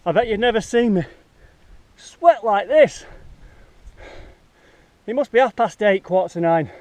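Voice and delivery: panting words out mid-hike; out of breath. Real, not acted. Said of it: "I have got a proper sweat on here".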